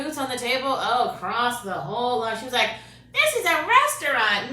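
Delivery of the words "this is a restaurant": mock indignant worker.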